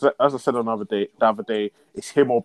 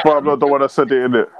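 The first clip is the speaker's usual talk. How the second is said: mocking quote; 2 semitones higher, 7 dB louder, faster than usual. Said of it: "and said, "It's not me".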